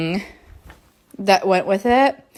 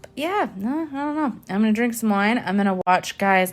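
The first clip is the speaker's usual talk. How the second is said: ordinary delivery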